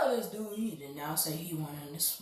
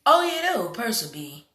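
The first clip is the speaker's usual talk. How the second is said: excited; 7 semitones higher, 10 dB louder than usual. Real, not acted. Recognizes someone excitedly.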